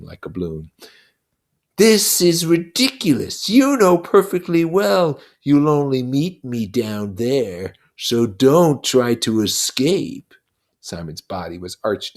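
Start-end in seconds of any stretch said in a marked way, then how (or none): 1.8-10.3 slow, higher, louder sneering demon voice; a buzzing devil taunting a child; Lord of the Flies, mocking. Lord of the Flies taunting Simon, slow and sneering.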